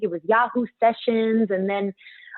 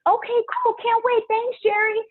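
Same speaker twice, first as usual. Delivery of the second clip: eager; 13 semitones higher than usual. Retold or imitated.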